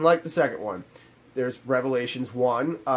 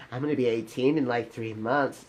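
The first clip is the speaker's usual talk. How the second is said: mock young girl; 3 semitones lower, 6 dB quieter than usual. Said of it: "she goes".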